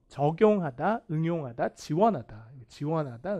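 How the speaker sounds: ordinary delivery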